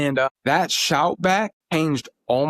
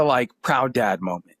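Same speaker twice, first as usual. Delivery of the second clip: ordinary talk, nothing marked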